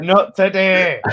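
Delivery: loud, slow and high, leaning on not; defiant not today. Real, not acted. Fan refusing a rival's chance, loud slow defiance. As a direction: plant your feet and refuse it. Defiant over imagined Moyes tackle.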